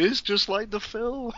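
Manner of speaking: high, drawn-out mock squeal of delight; gleeful imitation tipping into laughter; giddy fan glee. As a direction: act out a grinning fool's excitement, slow and high-pitched. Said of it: "you're grinning like a fool, going".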